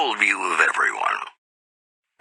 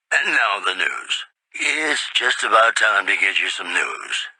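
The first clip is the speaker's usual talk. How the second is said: ordinary talk, nothing marked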